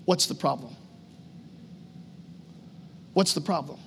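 low, soft question from the Spirit; quiet inner voice. Retold or imitated. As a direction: ask gently and low, leaving space. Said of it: "the Spirit spoke from my heart and said".

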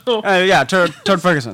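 loud, booming Turd Ferguson bit; mock Burt Reynolds swagger; Burt Reynolds impression. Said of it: "Burt Reynolds doing Turd Ferguson".

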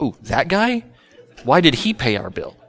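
mildly puzzled, pitch rising on the question; mock surprise. Ladies learning the man who scolded them paid, mildly baffled.

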